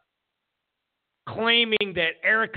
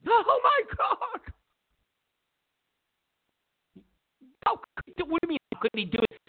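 mock disbelief; 10 semitones higher than usual. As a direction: sound scandalized, mocking it. Mocking Democrats' disbelief.

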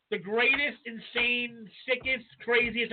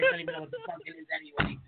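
fast, high, panicked babble; rushed nervous gabble to the casting producer; flustered rambling; 13 semitones higher, 4 dB quieter, faster than usual. Retold or imitated. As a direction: blurt it out in a rush, way up high. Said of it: "I was like".

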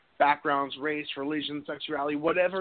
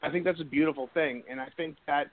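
usual speaking voice

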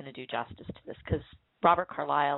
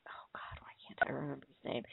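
whispered under the breath, a bit fast; frustrated whisper; 4 semitones lower, 12 dB quieter than usual. Real, not acted. Forgetting an actor's name mid-sentence, whispering to herself. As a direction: mutter it to yourself in a whisper.

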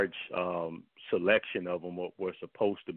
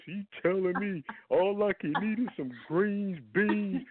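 much higher, tearful-sounding whine mocking a commenter; imitating whiny near-crying; 12 semitones higher than usual. Mocking an upset commenter who defends fried chicken, whiny near-tears voice. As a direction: whimper it like a grown man about to cry. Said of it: "he was saying it like he was gonna cry".

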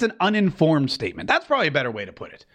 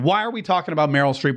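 ordinary talk, nothing marked

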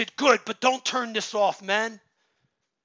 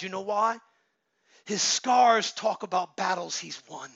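normal talking voice

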